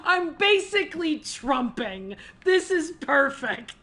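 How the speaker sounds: loud, high, gushing excitement; thrilled fan outburst. Real, not acted. Player spotting a favourite book reference, loudly thrilled. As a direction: burst out with delight at the discovery.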